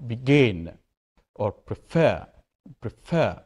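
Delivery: "begin" on GIN, "prefer" on FER; stress on second syllable. Shown as a demonstration. Teacher showing word stress, "begin" and "prefer" stressed on second syllables. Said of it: "I'm stressing the second syllable".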